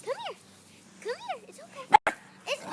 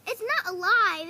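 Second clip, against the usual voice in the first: louder and slower, leaning on not; firm reassurance; 2 semitones lower, 10 dB louder than usual. Real, not acted. Owner firmly telling the dog the toy is not alive. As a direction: insist the toy is harmless.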